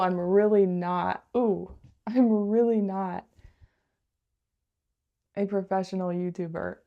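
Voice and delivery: ordinary talk, nothing marked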